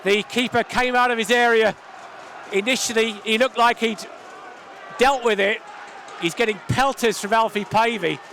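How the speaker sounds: loud, high post-goal buzz; excited commentary. Real, not acted. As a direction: still buzzing after the goal.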